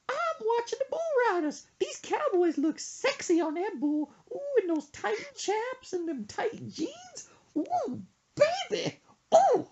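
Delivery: high falsetto swooning mom voice; impression of mom. Son imitating his mom drooling over bull riders, high falsetto. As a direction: gush over the cowboys in a high put-on mom voice. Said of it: "She said".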